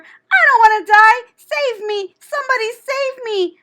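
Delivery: high, loud terrified pleading; panicked screaming. A narrator's reading. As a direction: beg for your life, screaming. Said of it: "screamed Wilbur".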